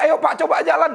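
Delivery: high-pitched healing preacher's command; Benihin. Retold or imitated. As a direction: imitate a faith healer ordering someone to walk. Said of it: "Saya tiru-tiru Benihin gitu".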